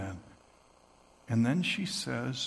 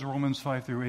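ordinary talk

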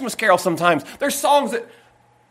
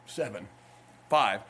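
usual speaking voice

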